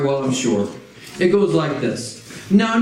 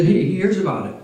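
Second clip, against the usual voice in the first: normal talking voice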